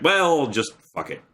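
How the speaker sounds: imitated exasperated shrug; mock giving up. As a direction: lightly act out throwing in the towel. Mimics a young person giving up.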